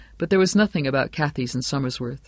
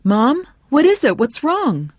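high, loud anxious questions; alarmed son; 11 semitones higher, 7 dB louder than usual. Acted. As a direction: call to mom in alarm.

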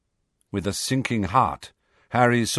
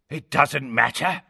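higher, menacing villain voice; man's voice; 4 semitones higher than usual. A narrator's reading. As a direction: call out as a pursuing enemy. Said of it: "said a man's voice".

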